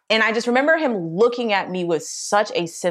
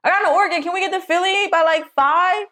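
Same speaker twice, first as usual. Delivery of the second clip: calling out; 11 semitones higher than usual. Retold or imitated. From the couch, shouting the organ news up to dad. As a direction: call the news upstairs.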